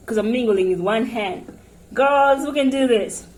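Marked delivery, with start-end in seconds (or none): 1.9-3.3 bragging. Real, not acted. Bragging to viewers.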